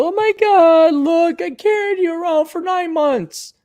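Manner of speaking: high, slow whiny mother voice; mother reminds her kid she carried him; mock mom. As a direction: parody the guilt-tripping mom. Mocking a mother's guilt trip.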